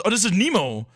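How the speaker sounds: normal talking voice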